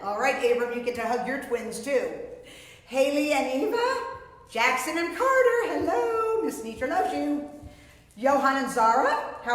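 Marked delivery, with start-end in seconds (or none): none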